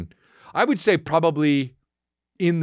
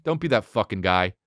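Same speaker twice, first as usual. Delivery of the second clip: normal talking voice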